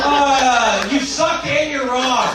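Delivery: hammed-up jab at a heckler; mock shout-out jab at the crowd. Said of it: "I'd love to shout out right now".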